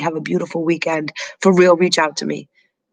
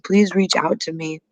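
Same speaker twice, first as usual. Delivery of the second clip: normal talking voice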